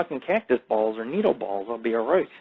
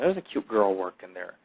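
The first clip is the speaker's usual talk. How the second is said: ordinary talk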